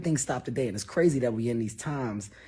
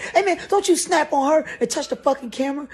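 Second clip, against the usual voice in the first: much higher, louder, fast yell; snapping shout; 14 semitones higher, 6 dB louder, faster than usual. Retold or imitated. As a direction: snap at a friend. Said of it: "I snap".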